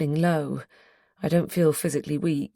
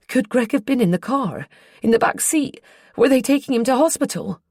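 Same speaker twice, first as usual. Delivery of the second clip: rising, higher, anxious questions; alarmed; 8 semitones higher, 5 dB louder than usual. A narrator's reading. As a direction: ask in mounting alarm. Alarmed questions about Greg.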